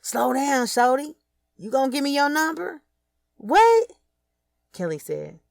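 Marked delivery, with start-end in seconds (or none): 0.0-2.8 calls out high and loud after her; yelled. Acted. Fats calling after Kelly from the car, loud high call. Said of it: "He yelled as I was getting out". 3.4-3.9 sharp high shout back; loud startled what. Acted. Kelly shouting back at Fats across the street, loud and startled.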